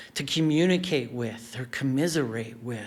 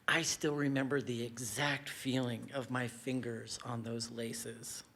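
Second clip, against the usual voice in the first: soft, unhurried-sounding storytelling, sinking quieter; hushed reminiscence; 2 semitones lower, 10 dB quieter than usual. Real, not acted. Storyteller recalling a grassy field with two boys, hushed reminiscence. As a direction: let the memory out softly. Recalling a cherished childhood memory.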